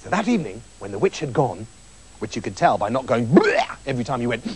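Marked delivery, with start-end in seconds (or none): 3.3-3.8 sudden high "bleh" noise; witch's squelchy burp. Retold or imitated. Narrator mimics the noise the witch makes, high squelchy bleh. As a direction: imitate a revolting squelchy retch.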